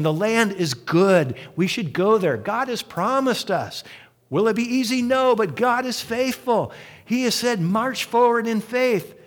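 rally the crowd to trust God; impassioned appeal to go forward; earnest urging. Retold or imitated. Caleb and Joshua urging the people.

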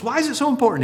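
deacon asking. Retold or imitated.

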